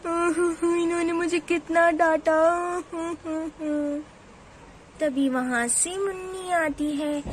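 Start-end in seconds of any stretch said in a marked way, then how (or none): 0.1-4.0 slow, sobbing complaint; रोते हुए. Acted. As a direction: wail the words slowly between hammed-up sobs. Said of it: "क्यों रो रही हो?". (रोने का अभिनय, सिसकियों के साथ)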